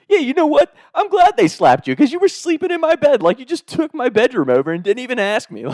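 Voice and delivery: high-pitched mock indignation; indignant scolding. Bed owner tells slapped friend he deserved it, high indignant scolding.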